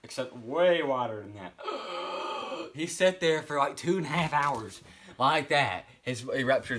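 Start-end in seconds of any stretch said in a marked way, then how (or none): 1.6-2.7 high, sharp pained gasp. Retold or imitated. Guy sitting in pain with his spleen gone, gasping. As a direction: gasp like a man in shock. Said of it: "the guy's just sitting there going".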